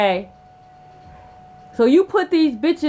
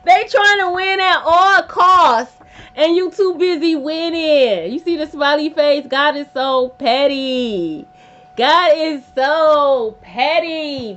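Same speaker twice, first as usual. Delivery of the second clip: emphatic delight; 3 semitones higher, 7 dB louder, slower than usual. Real, not acted. Delighted at the card message.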